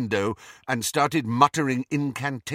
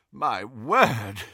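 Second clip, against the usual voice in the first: puffing; 4 dB louder than usual. A narrator's reading. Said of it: "he puffed".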